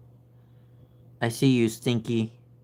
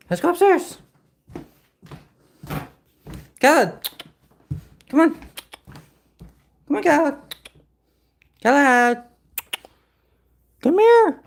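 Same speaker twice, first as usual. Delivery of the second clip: very high, loud calls; calling the cat; 13 semitones higher, 6 dB louder than usual. Real, not acted. Owner calling her cat upstairs, very high and loud. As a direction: summon him up the stairs.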